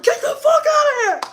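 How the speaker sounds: full-force retold furious shout; big boy voice. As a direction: roar it like the daddy of the house. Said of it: "put on my big boy drawers".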